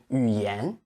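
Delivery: 语言 with second syllable 言 held long; correct stress. Shown as a demonstration. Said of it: "It should be 语言".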